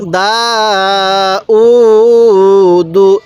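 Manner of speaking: দা and উ held long, দু short; one-alif elongation. Shown as a demonstration. Teacher showing how to read it, drawn-out দা উ, short দু. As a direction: stretch দা, stretch উ, then close briefly on দু. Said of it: "এরকমভাবে শিখব".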